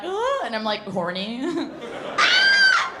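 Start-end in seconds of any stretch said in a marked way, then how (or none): 0.0-0.4 mock moan. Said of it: "and then I'm like". 2.2-2.9 piercing, very high loud shriek; mock shriek. Screaming in portal bit.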